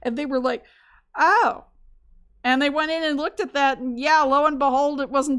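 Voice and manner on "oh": a mock surprised "oh", higher and louder. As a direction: fake sudden realization. Researchers realizing surprise.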